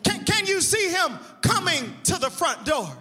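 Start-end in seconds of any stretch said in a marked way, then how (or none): none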